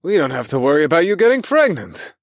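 loud, high punchline; joking. A narrator's reading. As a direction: deliver it as a big punchline. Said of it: "I continued my roll-on jokes".

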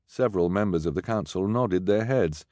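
usual speaking voice

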